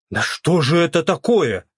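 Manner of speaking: the queue yells in outrage; indignant shout. A narrator's reading. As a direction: cry out in public indignation. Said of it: "закричала очередь".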